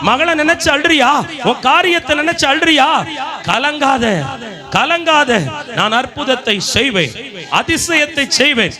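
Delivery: high, sustained revival-sermon cry; full-force preaching. Real, not acted. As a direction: proclaim each promise to the crowd in ringing phrases.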